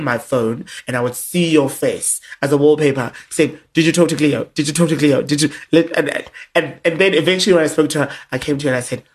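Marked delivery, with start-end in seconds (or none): 3.7-5.5 louder, insistent repeated asking; mock nagging. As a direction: badger her again and again. Imitating friend's repeated nagging.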